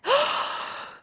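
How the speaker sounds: sharp inhale before holding breath; deep gasp. Shown as a demonstration. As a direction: gasp in a big breath of air. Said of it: "hold your breath".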